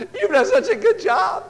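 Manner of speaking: put-on high, slow, sugary praise for a child; gushing praise. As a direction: lay the praise on thick like a proud parent.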